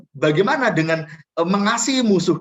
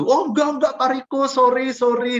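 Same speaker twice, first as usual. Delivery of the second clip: much higher, flustered sorry; apologetic neighbour; 9 semitones higher than usual. Retold or imitated. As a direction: hurriedly apologise like a caught neighbour. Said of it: "dia bilang gitu". (tetangga minta maaf dengan gugup, suara tinggi)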